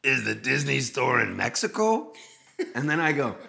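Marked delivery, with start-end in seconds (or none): 0.0-2.1 lightly voiced impression of the singer; a hint of another man's voice, asking. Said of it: "And [name] goes".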